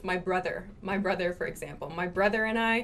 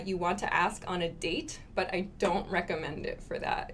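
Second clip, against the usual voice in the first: ordinary talk, nothing marked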